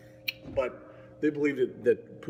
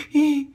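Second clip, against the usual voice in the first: very high, louder ghostly giggle; imitating a giggle; 10 dB louder than usual. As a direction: squeak out a tiny creepy giggle. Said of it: "this little thing go".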